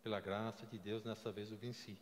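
normal talking voice

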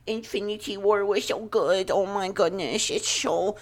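high, slurred mock-whiny voice; mocking naysayers. Host mimicking rival Marvel fans, mocking. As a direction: put on a silly high voice for dim fans. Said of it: "I don't wanna hear".

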